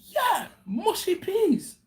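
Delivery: slow, high-pitched gleeful agreement; excited coworker. Retold or imitated. Coworker enthusing over mushy peas, excited impression. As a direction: imitate his delight, drawn out and high. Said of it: "his level of excitement for mushy".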